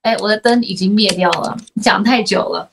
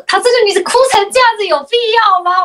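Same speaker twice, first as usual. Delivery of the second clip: higher, quick mock-exasperated jab; teasing exasperation; 9 semitones higher, faster than usual. Host teasing the guest who is crying on camera, higher and quick. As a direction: toss it off like a half-joking scold.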